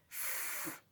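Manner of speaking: ف as a long voiceless f hiss; f sound. Shown as a demonstration. Teacher demonstrating the PH sound, a long ف hiss. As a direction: breathe out ف through teeth and lip. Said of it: "شبه صوت حرف ال F".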